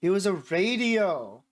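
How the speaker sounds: slow, drawn-out dramatic reveal; mock-momentous announcement; announcing important news. A narrator's reading. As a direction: announce it grandly, stretching the words. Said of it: "as if she was announcing some important news".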